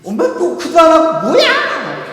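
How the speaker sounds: loud, high, drawn-out yell; teen yelling. Acted. Teenager caught at the computer yells at mother. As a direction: shriek indignantly at an intruder. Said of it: "소리를 지릅니다".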